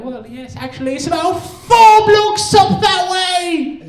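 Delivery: high mock British, building to a very loud finish; British accent. Comic giving a British stranger directions back, mock British accent building loud. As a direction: swell into a hammed-up British cry. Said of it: "making fun of their accent".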